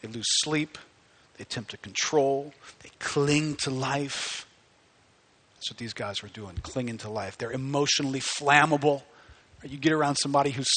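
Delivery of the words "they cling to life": slow, drawn-out cling; cling dragged long and heavy; slow stretched cling. Acted.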